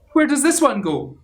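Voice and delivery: high loud puzzled question; puzzled skeleton. Acted. Big skeleton holding a stray bone, high and puzzled. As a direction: ask it up high, baffled. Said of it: "said the big skeleton".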